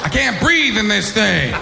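shouted, slow, high Pacino-style Vader; Al Pacino as Darth Vader. Retold or imitated. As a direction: yell it like Pacino playing Vader. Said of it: "his impersonation of Al Pacino as Darth Vader".

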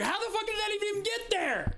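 can't believe the door exists; baffled outburst. Retold or imitated. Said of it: "I was like".

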